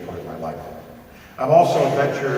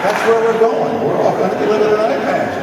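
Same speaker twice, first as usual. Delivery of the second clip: excited punchline; 11 semitones higher, 10 dB louder than usual. Real, not acted. Telling the audience where we're going, loud and excited. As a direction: land the joke big and loud.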